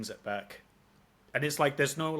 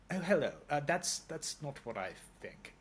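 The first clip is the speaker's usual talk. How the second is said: lightly mocking posh middle class English accent, a bit higher; half-hearted plummy "Oh, hello"; 5 semitones higher than usual. Londoner mocks people hamming up a middle class posh English accent. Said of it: "but that kinda accent".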